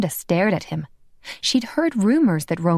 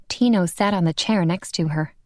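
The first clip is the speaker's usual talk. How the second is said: plain reading voice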